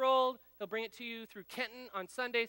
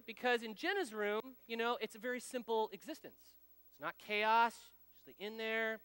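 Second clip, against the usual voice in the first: normal talking voice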